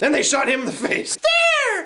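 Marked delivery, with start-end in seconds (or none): 1.1-1.8 very high, falling cartoon yelp; bear attack cry. First bear firing its stare, high-pitched cry. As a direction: launch the word high like a weapon.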